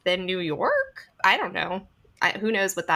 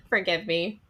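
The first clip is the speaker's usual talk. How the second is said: normal talking voice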